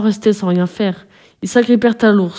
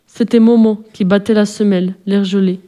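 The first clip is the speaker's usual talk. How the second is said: usual speaking voice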